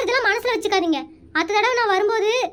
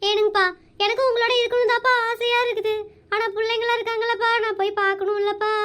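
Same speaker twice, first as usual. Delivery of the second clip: normal talking voice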